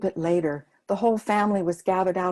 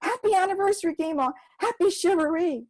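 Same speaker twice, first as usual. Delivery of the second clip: much higher and louder joyful shout; children shouting; 10 semitones higher, 5 dB louder than usual. Acted. Kids surprising Gima with a noisy shivaree, shouting gleefully. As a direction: yell it over the clanging pots. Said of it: "banging on them and shouting".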